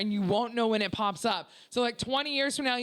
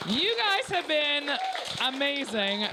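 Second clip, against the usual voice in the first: calling out; 6 semitones higher, slower than usual. Real, not acted. Calling out to crowd.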